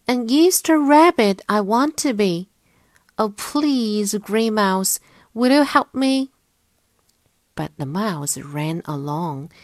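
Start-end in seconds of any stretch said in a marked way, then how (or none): 0.1-6.3 high, loud pleading bunny voice; bunny calling out. A narrator's reading. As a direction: call out and beg for help.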